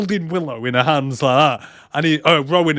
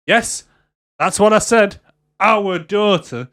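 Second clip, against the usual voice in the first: raised and louder, "our daughter" drawn out slowly; exasperated insistence; 4 semitones higher, 4 dB louder, slower than usual. Retold or imitated. Mother confirming to the man it's their child, exasperated. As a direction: spell it out impatiently, stretching "our daughter". Acted exasperated reply in confrontation.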